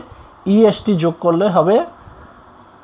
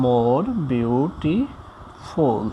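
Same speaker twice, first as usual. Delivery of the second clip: very slow and softer, syllable by syllable; slow dictation; 4 semitones lower, 7 dB quieter, much slower than usual. Shown as a demonstration. Teacher repeating the comparative form for learners, slow dictation. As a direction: draw out MORE BEAUTIFUL, pausing between its parts.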